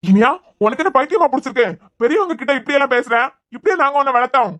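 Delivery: angry scolding. Acted. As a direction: shout her down for disrespect. Father scolds daughter for disrespect. (மரியாதை இல்லாமல் பேசிய மகளை உரத்த கோபத்துடன் கண்டித்து)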